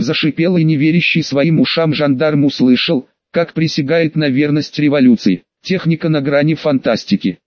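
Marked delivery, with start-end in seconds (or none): none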